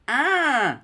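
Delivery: high sinister giggling cackle; giggly sinister sing-song laugh; evil clown laugh. Pretend. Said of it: "that's my killer clown laugh".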